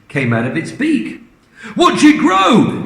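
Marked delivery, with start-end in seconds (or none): 1.8-2.8 high, slow, louder cry; excited shout. Acted. Mister Cranky cheering on the hen, slow high shout. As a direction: crow it out triumphantly. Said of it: "shouted Mister Cranky".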